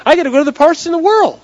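high, loud mock exasperation; exasperated protest. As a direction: blurt it out high and loud, brushing someone off. Acts out Leakey's exasperated reply.

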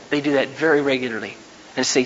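usual speaking voice

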